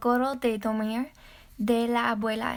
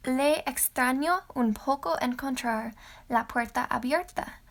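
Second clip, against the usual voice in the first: ordinary delivery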